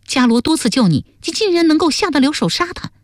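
high-pitched, reproachful character voice; accusing young woman figure. Acted. Duan Sengnu accuses Lingyun of killing her friend, high and reproachful. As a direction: confront someone over a betrayal, pitched high.

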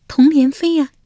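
high, coy court lady voice; lady Wenjiang. A narrator's reading. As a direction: answer sweetly as the young wife. (文姜娇声作答)

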